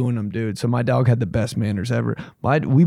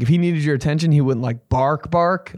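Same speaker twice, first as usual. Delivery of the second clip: usual speaking voice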